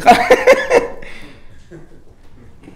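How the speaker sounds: high, breaking-up laugh; real laughter. Real, not acted. Speaker amused by his driver skit, laughing. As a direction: burst out laughing.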